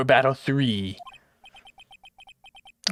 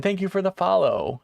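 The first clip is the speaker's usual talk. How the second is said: normal talking voice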